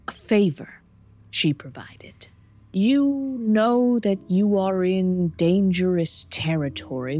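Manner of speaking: slow, halting fiend with long pauses; ominous, unhurried demon warning; deliberate demon. Acted. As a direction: dole out the words slowly, trailing into a hush. Game master voices the demon.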